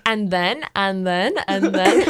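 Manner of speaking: "And then" repeated, each one rising; repetitive storytelling filler. Retold or imitated. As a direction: lift the end of every "and then", as if stringing a story on. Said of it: "I'd be like".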